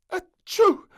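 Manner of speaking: big high sneeze; loud sneeze. Acted. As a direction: sneeze hard. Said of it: "sneezed the little princess".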